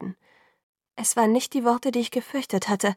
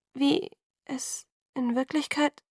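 slow, halting, higher trail-off; falling silent; 5 semitones higher, slower than usual. A narrator's reading. Bella faltering under Alice's look, falling silent. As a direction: let the sentence falter and stop. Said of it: "ich verstummte".

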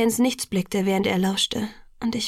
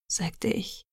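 usual speaking voice

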